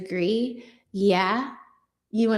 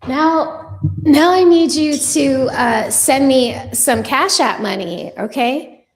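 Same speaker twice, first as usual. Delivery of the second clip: mock demand; 2 semitones higher, 7 dB louder than usual. Joking at a viewer who asked for her degree, loud mock demand. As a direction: call out the commenter loudly, half joking.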